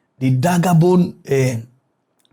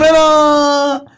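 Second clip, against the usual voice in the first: high, loud exasperated woman; higher, louder incredulous call; exasperated cry; 8 semitones higher, 4 dB louder than usual. Real, not acted. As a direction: cry out in disbelief. Exasperated exclamation.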